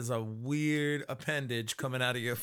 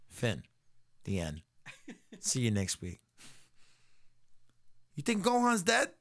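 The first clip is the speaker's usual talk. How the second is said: ordinary talk, nothing marked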